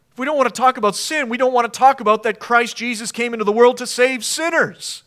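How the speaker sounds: building preacher's cry; rising to a shout. Real, not acted. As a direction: drive the point home with force. Preacher builds to emphatic point.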